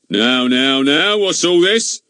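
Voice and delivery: loud, slow constable; bossy village policeman. A narrator's reading. Policeman Goon arriving.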